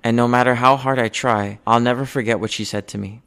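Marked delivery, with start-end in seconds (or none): none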